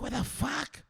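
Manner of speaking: very high, soft squeak; mock panic. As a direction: echo his own panic in a squeak. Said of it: "I was like".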